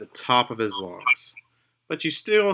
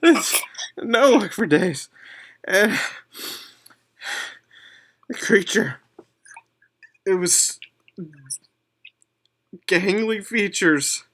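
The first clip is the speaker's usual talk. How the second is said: higher, hurried voice of a shaken elf; frightened elf; 8 semitones higher than usual. Acted. Elf recounting the attack to the party, high and shaken. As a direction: tell it like you barely escaped.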